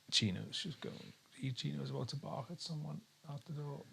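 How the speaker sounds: aside. Real, not acted. Talking about dog aside.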